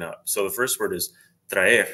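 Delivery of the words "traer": traer said as two syllables, tra-er; Spanish hiatus. Shown as a demonstration. Teacher showing learners an example, traer as tra-er, hiatus. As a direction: pronounce traer with the vowels kept apart. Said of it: "I'll pronounce the word for you".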